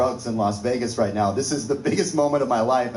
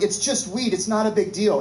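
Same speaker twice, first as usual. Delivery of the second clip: nervous plea; 9 semitones higher than usual. Retold or imitated.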